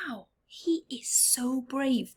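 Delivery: soft whispered wonder; admiring whisper. Real, not acted. Whispering to the children how brave the boy is.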